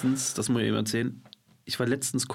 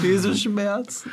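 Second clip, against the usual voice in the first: slow, high tragic lament; passion play agony; 8 semitones higher, 4 dB louder, much slower than usual. Acted. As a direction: wail like a suffering martyr. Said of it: "meine Bewerbung für die Passionsspiele". (leidend wie bei den Passionsspielen)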